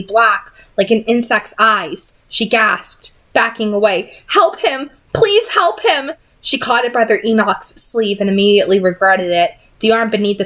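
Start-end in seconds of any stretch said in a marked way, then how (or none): 4.3-6.1 high, slow, frantic begging; desperate plea. A narrator's reading. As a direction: beg for help in panic. Tessa begs for her brother.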